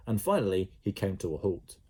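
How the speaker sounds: usual speaking voice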